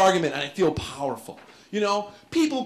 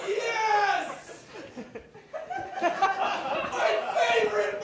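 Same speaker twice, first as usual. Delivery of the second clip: much higher, laughing squeal; mock ecstatic; 13 semitones higher, much slower than usual. Shopper thrilled to find the movie, squealing. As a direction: squeal with giddy joy. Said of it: "you know, like".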